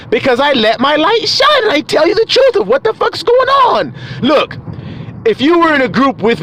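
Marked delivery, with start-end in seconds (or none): 0.1-3.9 very high, insistent cry; hollered answer. Acted. Grandson defending how he talks, high insistent holler. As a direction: answer back at a high pitch. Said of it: "Why you talk like that".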